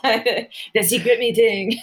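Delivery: drawn-out mock French accent; slow, jokey fake French accent; terrible French accent. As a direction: slowly ham up a bad French accent. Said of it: "I always say it with a French accent".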